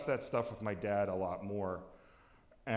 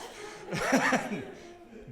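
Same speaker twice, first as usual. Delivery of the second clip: laughter; 10 semitones higher than usual. Real, not acted. Laughing at dad's request.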